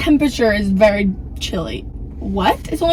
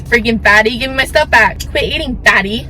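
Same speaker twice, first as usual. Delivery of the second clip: loud taunting; 9 dB louder than usual. Acted. Skit, yelling at a food thief.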